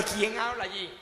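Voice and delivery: soft, puzzled question on the phone; a hushed, puzzled who's there; puzzled sister. Retold or imitated. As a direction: ask who's calling, unsure and quiet. Sister doesn't recognize voice on phone.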